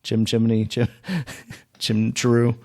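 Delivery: silly, lightly put-on British accent; joking British accent; mock British accent. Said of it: "That's not what a British person sounds like".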